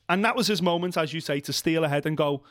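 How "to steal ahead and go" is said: ordinary talk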